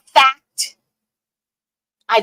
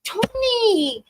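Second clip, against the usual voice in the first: high, louder, slowed-down scolding; disgusted scolding; 11 semitones higher, 3 dB louder, slower than usual. Retold or imitated. Scolding her sister over the shared toothbrush, high and appalled. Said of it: "I said".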